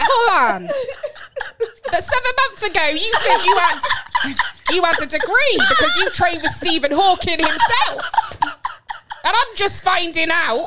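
high, loud indignant confrontation; mock outrage. Confronting a date about lies they told months ago, mock outrage. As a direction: call out the liar, hammed-up and disbelieving.

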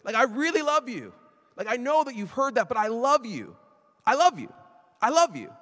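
an overflowing, high, loud I love you; God's loving voice. Retold or imitated. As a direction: call it out like God insisting on love. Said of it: "is God saying".

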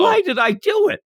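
a sudden high yelp of regret, hammed up; mock regretful shout. Podcaster hams up the greedy wisher's regret, mock shout. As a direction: cry out why you did it, half joking.

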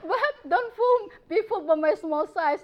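words broken by a high laugh. Real, not acted. Tiny doctor joking about her size, laughing.

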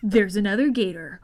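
usual speaking voice

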